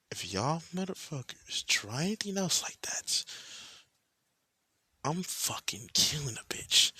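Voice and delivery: a menacing warning, slowing on the last part; angry warning. Acted. Narrator warning the girls off Michael, angry threat. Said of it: "with an angry expression".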